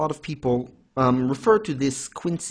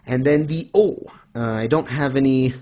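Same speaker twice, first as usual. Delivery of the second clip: ordinary delivery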